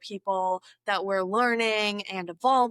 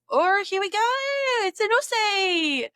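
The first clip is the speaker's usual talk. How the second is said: Aussie accent; 11 semitones higher, 3 dB louder than usual. Shown as a demonstration. Vlogger demonstrates for viewers, in an exaggerated Aussie accent. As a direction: exaggerate an Australian accent, high and stretched out. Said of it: "I'm gonna try some Aussie accents".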